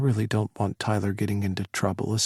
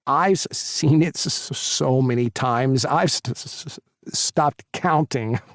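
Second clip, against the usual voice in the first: stutter. Acted. Jeremy stutters.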